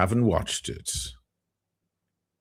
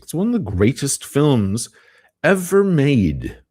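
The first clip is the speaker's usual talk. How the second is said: higher and louder, slowing on "ever made"; enthusiastic praise; 9 semitones higher, 7 dB louder than usual. Real, not acted. Urging viewers to watch Amadeus, excitedly. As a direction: gush about the film with real excitement.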